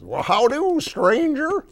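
very high, loud frog character; folksy frog hailing a stranger; high-pitched cartoon frog greeting; Beauregard the Frog. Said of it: "Beauregard the Frog".

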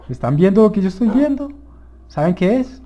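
fast, high, loud burst of discovery; excited. Real, not acted. Player asking viewers if they see the new find, excited. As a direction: rush it out loud, thrilled at the find. Spots another village, alerts viewers.

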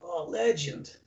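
mock Aussie "legend", lightly put on; imitated Australian slang. Teasing Australian cohost about slang, mock Aussie accent. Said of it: "You guys like the word legend".

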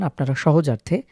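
normal talking voice

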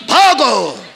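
high, long-drawn call to flee; mock retreat cry. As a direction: stretch out a comic battlefield yell. Acted army fleeing after second retreat. (पळ काढण्याची लांबलचक आरोळी)

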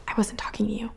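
hissed aside under the breath; whispering. Acted. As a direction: whisper it sharply, annoyed. Irritated aside to the manager.

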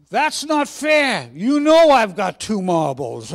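loud, drawn-out Cockney protest; Cockney accent. Acted. Second boy caught out over his marbles, loud slow Cockney protest. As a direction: wail it indignantly as a Cockney boy. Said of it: "Should I put on a Cockney accent".

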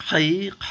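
Arabic kha sound; throaty kha. Shown as a demonstration. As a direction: push খ out from inside the throat. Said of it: "একটু ভিতর থেকে বের করে".

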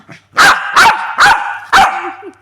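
loud, high burst of laughter; high shrieking laugh. Real, not acted. Laughing at the angry pet.

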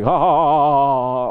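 loud mock guffaw like a fool; idiot laugh. Preacher imitates someone laughing like an idiot. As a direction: cackle mindlessly, hammed up. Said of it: "all the time like an idiot".

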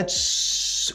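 unvoiced tss of a burning fuse; fuse hiss. Shown as a demonstration. Teacher imitating a dynamite fuse burning, unvoiced hiss. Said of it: "E fica chiando".

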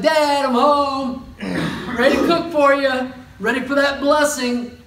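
high, loud, laughing excited son. Retold or imitated. Esau back from the hunt calling to his dad, excited and loud. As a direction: bounce in, thrilled to be home. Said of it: "he comes back in excited".

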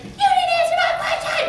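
loud, very high mock outrage. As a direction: imitate an angry fan shrieking a complaint. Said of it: "she's raising her voice".

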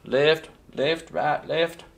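playful high drill-sergeant count; marching drill call. Pretend. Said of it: "It sounds like marching".

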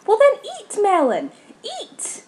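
very high, squealed command; exasperated yell. Real, not acted. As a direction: order the character in a high-pitched outburst. Exasperated order to game character.

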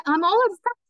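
high, pleading excuse cut short; flustered protest. A narrator's reading. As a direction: protest anxiously until interrupted. Embarrassed pianist protesting, cut off.

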